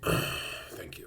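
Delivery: a soft breathy sigh then a whispered thanks. Real, not acted. Streamer thanking a donor after the read, sighed whisper. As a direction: let the air out and whisper it.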